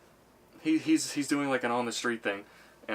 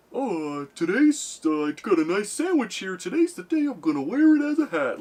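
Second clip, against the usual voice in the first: much higher and louder silly voice; goofy passerby voice; 8 semitones higher, 7 dB louder than usual. Retold or imitated. Comedian mimics a man with a sandwich hat, goofy high squeal. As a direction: squeal it like a clueless weirdo. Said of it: "he's like".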